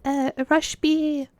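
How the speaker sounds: put-on a squeaky hesitant girl voice giving orders; timid gamer girl. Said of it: "the girl being like".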